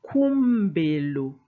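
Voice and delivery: Kumbelu slow, with a clear mb; Chiluba Kumbelu. Shown as a demonstration. Teacher models the mb cluster slowly. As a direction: stretch Kumbelu. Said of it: "M with a consonant".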